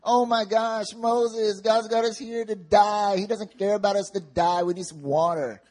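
high-pitched mock whine; whining. Thirsty Israelites complain to Moses, whining. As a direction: complain high and loud, drawing out the words. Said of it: "so they start whining".

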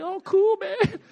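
lightly voiced, high laid-back dude; hint of a relaxed surfer; chilled-out servant. Servant relieved after seeing the angels, mock laid-back. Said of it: "he's chilling now too".

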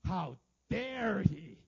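loud, drawn-out outrage; hammed-up indignation, every word stretched; indignant flesh. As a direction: act out a big offended outburst. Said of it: "this fleshliness is like".

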